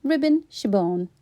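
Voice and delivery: plain reading voice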